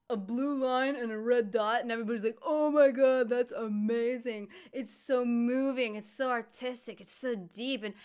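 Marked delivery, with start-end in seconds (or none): 2.5-7.8 high, loud, over-awed praise; hammed-up admiration, opening big; mock gushing. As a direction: imitate art fans swooning over it. Mock-quotes crowd praising bad art.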